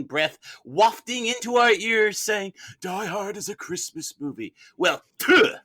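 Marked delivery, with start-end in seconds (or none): none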